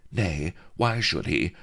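usual speaking voice

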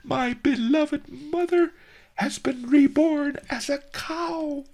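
falsetto shriek, loud and overcome; high screeching wail. A narrator's reading. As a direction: wail it in ecstatic grief. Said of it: "he screeched".

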